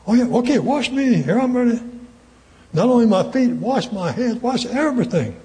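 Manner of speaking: high, eager rush of consent. Acted. Preacher acts impulsive Peter begging Jesus to wash him, eager.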